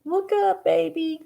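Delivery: high cooing pet voice. Real, not acted. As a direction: coo at the kitten, high. Talking to the cat.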